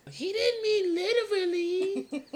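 put-on squeaky, slow, laughing kid voice; laughing high. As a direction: laugh through it in a high child voice. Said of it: "starts hopping around".

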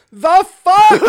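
high, very loud yell; hammed-up furious disbelief; mock outrage. Wizard whose door just exploded, outraged.

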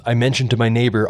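usual speaking voice

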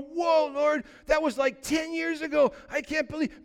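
high-pitched acted dismay; hammed-up startled protest; dismayed astonishment. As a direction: exclaim high to God, taken aback. Acts dismay in prayer meeting.

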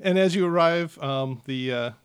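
usual speaking voice

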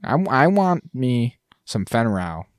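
ordinary delivery

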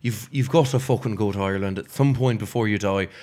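lilting talk as an Irishwoman in an Irish accent. Shown as a demonstration. Performer showing friends an Irishwoman on request, Irish accent. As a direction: praise the country like an Irish local, in Irish accent. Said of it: "We wanna hear the Irish accent".

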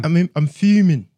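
loud, high mock London street voice; Top Boy London accent. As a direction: play fuming in a London accent. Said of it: "doing some Top Boy shit".

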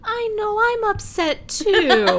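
very high, drawn-out pout; mocking upset whine. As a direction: mimic her wailing, stretched and high. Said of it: "she just looks at him and she goes".

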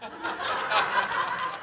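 silent wheezing laugh; breathy laugh. Real, not acted. Laughing at her demand.

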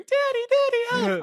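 very high, squeaky little duckling voice; mock pleading in a tiny high voice; child crying out. Voices imprisoned duckling calling father.

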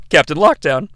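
ordinary talk

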